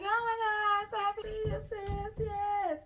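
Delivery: very high mock squeal; gushing greeting. Friend greets her sis on a call, high gushing imitation.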